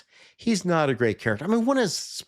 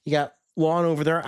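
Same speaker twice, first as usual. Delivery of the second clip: ordinary delivery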